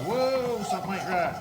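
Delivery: startled high, loud cry of alarm; mock panic at the pan, high and loud; scared whoa. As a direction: jump back like the gravy went wrong. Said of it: "you're gonna be like".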